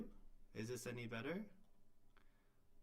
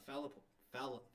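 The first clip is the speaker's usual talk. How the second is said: ordinary talk, nothing marked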